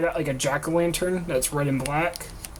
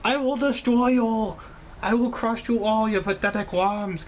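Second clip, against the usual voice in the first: higher, booming Terminator-style threat; barbarian; 6 semitones higher than usual. Retold or imitated. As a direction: growl out a villain's threat. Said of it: "kind of just like the Terminator".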